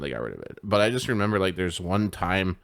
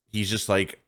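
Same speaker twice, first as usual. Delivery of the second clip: normal talking voice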